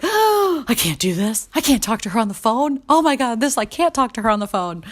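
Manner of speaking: high, breathless panic after a gasp; mock panic, as if the phone call is a disaster; freaking out. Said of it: "totally freaking out".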